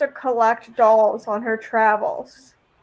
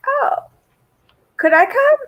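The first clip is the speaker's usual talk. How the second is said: eager guest; 14 semitones higher than usual. Retold or imitated. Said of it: "Jason's like".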